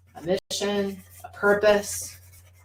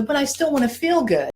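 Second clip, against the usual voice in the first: put-on toddler pleading; 7 semitones higher than usual. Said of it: "the toddler can go".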